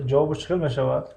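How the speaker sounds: usual speaking voice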